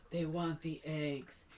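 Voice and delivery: slow, soft mock-dramatic murmur; imitated ominous hush; hushed realization.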